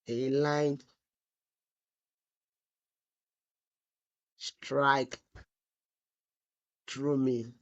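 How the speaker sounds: broken into slow pieces with long gaps; typing aloud. Real, not acted. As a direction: say it piece by piece as you type. Dictating to self while typing.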